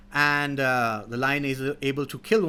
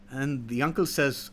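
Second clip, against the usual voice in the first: ordinary delivery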